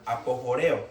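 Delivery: "apojoreo" with a Spanish J sound; pronounced with J. Shown as a demonstration. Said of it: "con J".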